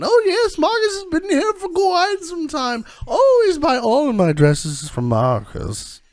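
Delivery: high, loud haughty noble lady voice; snooty noblewoman. Acted. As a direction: look down your nose at the shopkeeper. Noblewoman butts into conversation.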